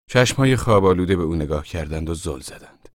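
ordinary delivery, nothing marked

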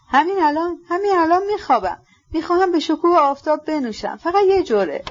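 high, agitated insistence; feverish excitement. A narrator's reading. Ippolit announcing a drink to the sun, feverishly excited. Feverish reply before suicide attempt.

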